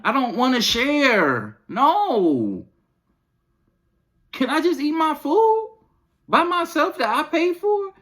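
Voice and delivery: put-on high-pitched, fed-up plea to the kids; exasperated rant. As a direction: whine it high like a parent at the end of her rope. Acted rant at kids over food.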